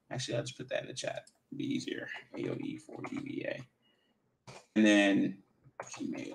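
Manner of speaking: whispered under the breath while typing; soft whisper to self. Real, not acted. Mutters email while typing it.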